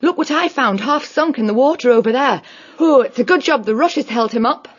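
high-pitched, out of breath from swimming; winded after a hard dive; panting. Acted. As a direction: speak while catching your breath. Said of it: "Winifred panted".

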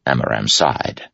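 ordinary delivery, nothing marked

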